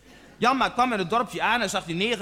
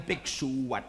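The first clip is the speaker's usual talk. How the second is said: low, quieter comeback; cool comeback; 9 semitones lower, 8 dB quieter than usual. Acted. Stanley topping Janmaat's retort, low and cool. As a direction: drop it low to top the retort.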